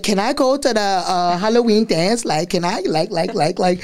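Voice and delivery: put-on high-pitched suburban American accent, littered with "like"; mimicked suburban American accent. Child showing off to mom, mimicked suburban American accent. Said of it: "I'm good at adjusting the accents".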